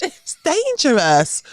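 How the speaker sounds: put-on slow, high, prim English accent; proper English accent. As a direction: draw it out primly in an English accent. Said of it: "I could hear myself sounding so English".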